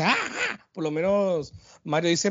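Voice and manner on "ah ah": high-pitched hero grunts, short and sharp; Link grunt. Retold or imitated. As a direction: squeak out a tiny wordless hero cry. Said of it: "Link por lo menos dice".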